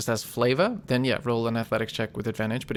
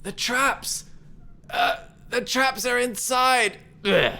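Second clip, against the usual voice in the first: put-on high, strained kid using the last of their energy; dying gasp; 12 semitones higher, slower than usual. Felled kid warning from the snow, with last strength. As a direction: force the warning out, then collapse with a groan. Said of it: "with that last bit of energy".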